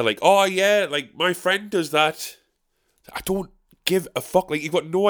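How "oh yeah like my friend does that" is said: mock heckler answer. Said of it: "she would answer".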